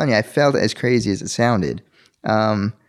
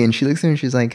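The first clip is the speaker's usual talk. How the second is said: ordinary talk, nothing marked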